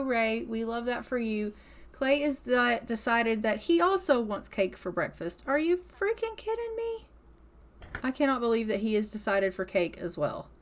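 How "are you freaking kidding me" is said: much higher, outraged disbelief; incredulous exclaim. Real, not acted. Player sees sim choose cake too, high incredulous cry. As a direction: shriek of mock outrage.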